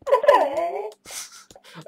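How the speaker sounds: loud high-pitched laughing fit; giddy high laugh. Real, not acted. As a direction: burst into a giddy shrieking laugh. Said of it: "I'm a little bit high".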